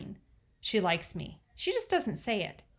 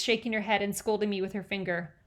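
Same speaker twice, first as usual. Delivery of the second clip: ordinary delivery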